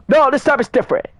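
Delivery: high, insistent reply; defensive producer. Retold or imitated.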